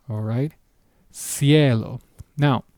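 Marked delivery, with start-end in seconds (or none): 1.1-2.0 Cielo with the C pronounced as S; soft C. Shown as a demonstration. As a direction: clear s at the start of Cielo. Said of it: "has the S sound".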